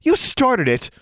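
high, sharp comeback; snapped. A narrator's reading. Anna blames Tomo in their quarrel, snapping. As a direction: snap it back accusingly. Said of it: "Anna snapped".

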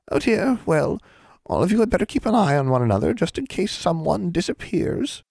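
high-pitched mock concern; teasing, menacing hag. A narrator's reading. Hag warns children after the girl's accusation, teasing menace. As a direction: warn them playfully, slowing into menace.

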